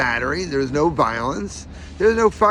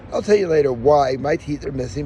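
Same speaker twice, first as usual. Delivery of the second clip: usual speaking voice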